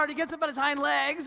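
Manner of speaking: ordinary talk, nothing marked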